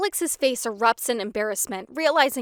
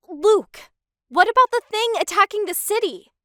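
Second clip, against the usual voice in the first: high, loud alarmed call; loud, high and urgent; urgent shout; 10 semitones higher, 11 dB louder than usual. A narrator's reading. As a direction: cut him off with urgency. Urgent cry over the attack.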